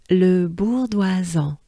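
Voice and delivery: "Le bourdoisan" slowly joined in careful French; slow, connected French "Le bourdoisan"; whole name together. Shown as a demonstration. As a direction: repeat "Le bourdoisan" slowly for learners. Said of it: "again, good breath".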